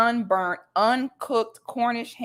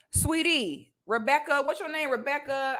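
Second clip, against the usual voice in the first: higher, mock-sweet tone; condescending sweet; 5 semitones higher, 4 dB quieter than usual. Real, not acted. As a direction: talk down to her sweetly. Condescending to woman.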